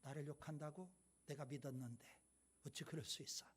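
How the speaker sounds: quietly stung by a friend's betrayal; hurt betrayal. A narrator's reading. Second bison hearing the lie, softly hurt. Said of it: "서운한 마음이 들어와요".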